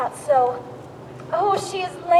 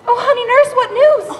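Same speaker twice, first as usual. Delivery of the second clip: excited; 5 semitones higher, 7 dB louder than usual. Acted. Juliet greets the returning nurse.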